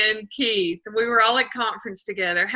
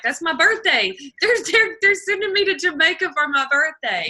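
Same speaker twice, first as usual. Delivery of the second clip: higher, louder excited boasting; bursting with birthday glee; 5 semitones higher, 3 dB louder than usual. Retold or imitated. Quoting excited colleague.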